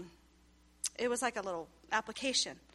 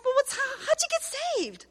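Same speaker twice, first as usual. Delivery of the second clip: much higher, teasing take on the husband; mock husband voice; 13 semitones higher than usual. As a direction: play the husband in a light mock voice, pitched up. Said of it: "And he goes".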